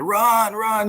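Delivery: high, loud yell of run run; excited yelling. Retold or imitated. Father watching on TV yells at his son running to first, high and loud. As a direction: yell him down the line, pitch jumping way up. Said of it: "I'm going banana".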